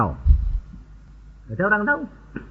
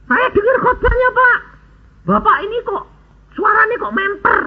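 imitating the car seller, pitched up and wondering; high puzzled seller. Car seller to the preacher, a higher puzzled mock voice. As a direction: voice him higher, squinting at a familiar voice.

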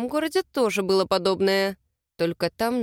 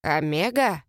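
lightly voiced drunk Alpha; 6 semitones lower than usual. A narrator's reading. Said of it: "drunk Alpha voiced in story".

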